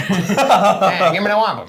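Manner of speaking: high, loud, laughing through it; laughing mock demand. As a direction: demand it while cracking up. Acted quote with laughter.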